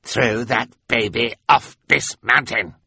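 lightly voiced impatient villain; impatient Olaf. A narrator's reading. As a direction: demand obedience. Olaf repeats order.

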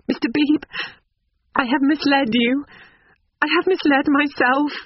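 put-on high-pitched, earnest young woman; distressed confession. A narrator's reading. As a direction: confess in a very high, pained character voice. Tearful Lucy confesses to Mister Beebe.

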